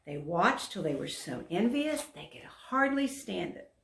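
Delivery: plain reading voice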